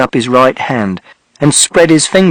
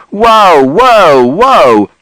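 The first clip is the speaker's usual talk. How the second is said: loud, falling calls to stop; holding them back with a loud whoa; Mister Shears shouting; 5 semitones higher, 4 dB louder, much slower than usual. A narrator's reading. Mister Shears shouting to stop the fight. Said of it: "And Mister Shears shouted".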